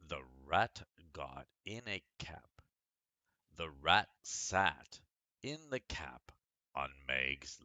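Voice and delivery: lively storybook reading; story reading. Acted. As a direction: read it like a picture book to children. Reads a picture book aloud.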